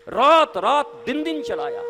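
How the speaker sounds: slow, loud and high boast; loud emphatic. Real, not acted. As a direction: hammer out every word. Boasting of driving day and night.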